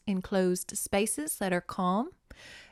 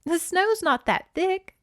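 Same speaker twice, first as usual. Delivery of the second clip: put-on higher girl's voice, lightly joking; a higher girl's voice making a joke; 10 semitones higher, 4 dB louder than usual. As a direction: tease her gently in a higher voice. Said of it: "[name] joked".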